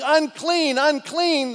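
high loud warning yell; yelling unclean. Retold or imitated. Lepers warning people away, loud yell. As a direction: shout the warning out. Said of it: "They weren't yelling".

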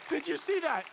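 high-pitched, eager retold question; acted excitement, pitched well up; excited question. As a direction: fire it off high, all worked up. Excited repeat to the next person.

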